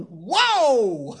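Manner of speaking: loud, high re-enacted amazement; amazed outburst. Preacher watching healed man run off, amazed. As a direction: let out a big, high cry of astonishment.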